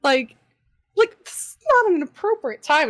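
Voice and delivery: high rising what the fuck; incredulous. Retold or imitated. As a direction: disbelief shooting up in pitch. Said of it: "and I was like".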